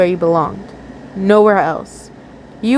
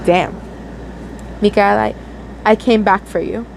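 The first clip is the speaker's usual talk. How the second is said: ordinary delivery, nothing marked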